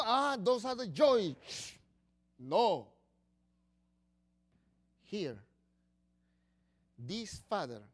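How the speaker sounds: normal talking voice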